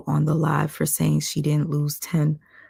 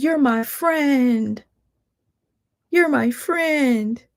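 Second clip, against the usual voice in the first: much higher, slow syrupy mock; mocking imitation; 9 semitones higher, 3 dB louder, much slower than usual. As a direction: drag it out to mock her fake friendship. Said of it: "she's like".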